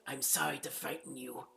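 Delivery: a lightly put-on lizard-folk guard; Argonian guard voice. Guard apologizing to startled housekeeper, lightly voiced Argonian. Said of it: "They sound like this".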